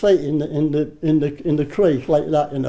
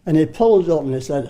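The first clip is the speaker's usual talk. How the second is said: ordinary delivery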